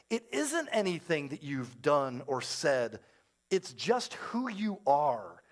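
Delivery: slow, soft, measured put-down; grave verdict. Retold or imitated. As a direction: imitate her quiet, deliberate judgement. Acted cold verdict from Nanette.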